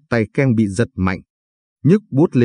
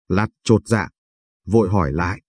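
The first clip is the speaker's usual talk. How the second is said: usual speaking voice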